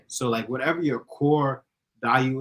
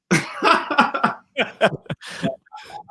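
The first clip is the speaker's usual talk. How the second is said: loud, high cackling laugh; burst of laughter; 14 semitones higher, 5 dB louder than usual. Real, not acted. Guest cracks up over his butt chin, loud laughter. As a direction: crack up at your own joke.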